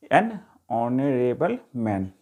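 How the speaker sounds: एन honourable मैन said slowly and deliberately, leaning on एन; slow demo. Shown as a demonstration. Teacher slowly modelling the phrase for students. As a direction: spell it out word by word for the class. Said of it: "फिर एच का sound नहीं आ रहा".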